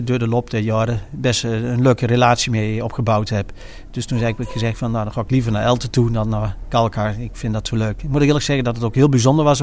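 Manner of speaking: ordinary delivery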